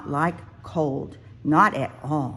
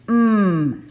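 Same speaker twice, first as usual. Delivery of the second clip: loud happy mmm of tasting; munching mmm; 4 semitones higher, 7 dB louder than usual. A narrator's reading. Cub tasting acorns up in the tree, happy mmm. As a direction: savour the acorn, louder.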